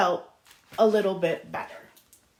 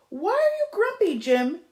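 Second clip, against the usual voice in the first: others asking; 8 semitones higher than usual. Acted. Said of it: "asked the others".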